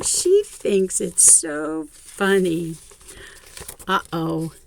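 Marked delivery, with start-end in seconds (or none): none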